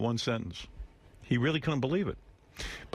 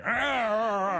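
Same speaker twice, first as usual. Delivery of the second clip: mock angry monster growl, pitched up; 10 semitones higher, much slower than usual. Host shows how angry J D sounded, mock monster roar. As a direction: groan like an angry Frankenstein. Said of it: "He was so angry".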